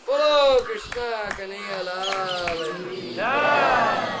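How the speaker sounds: loud, slow chanting shout to the crowd; devotional call. Real, not acted. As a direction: call out to lead the crowd's reply.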